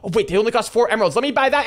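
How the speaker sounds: usual speaking voice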